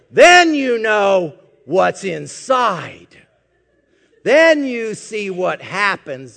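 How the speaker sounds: loud, slow, shouted sermon; preacher shouting the point to the church; full-force preaching. Acted. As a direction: hammer it home to the congregation, loud and slow. Preaching climax on resilience.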